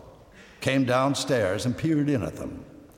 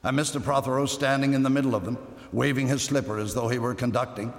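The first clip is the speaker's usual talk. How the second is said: plain reading voice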